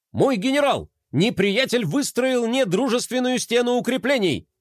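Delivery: high, brisk soldier report. A narrator's reading. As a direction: report eagerly to the general. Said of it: "бодро доложил он".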